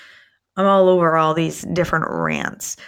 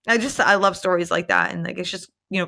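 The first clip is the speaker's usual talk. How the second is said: usual speaking voice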